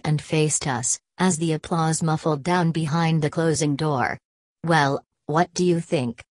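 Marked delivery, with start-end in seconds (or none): none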